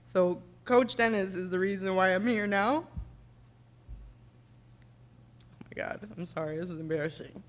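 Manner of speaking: tearful, choked-up apology; fighting back real tears, voice breaking; real crying. Real, not acted. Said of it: "God, I'm gonna get emotional".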